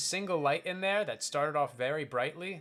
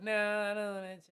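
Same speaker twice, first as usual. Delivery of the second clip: mock whiny refusal; 7 semitones higher than usual. Friend refusing a bite of seafood, mock whiny. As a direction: imitate a wimp turning down food.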